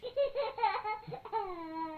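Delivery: quick high little laugh; light high-pitched giggle. Acted. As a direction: giggle like a girl with a full mouth. She laughed with mouthful of porridge.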